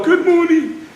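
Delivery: put-on a lifted, sing-song old man's greeting; old man's reply. Old father greeting the village women, a lifted sing-song hello. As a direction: greet them as the kindly village elder.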